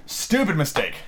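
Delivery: usual speaking voice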